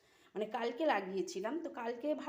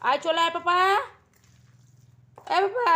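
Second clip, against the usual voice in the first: loud, high call from afar; 9 semitones higher, 18 dB louder than usual. Real, not acted. Calling Kutus back from a distance, loud high shout. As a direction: shout for him to come back.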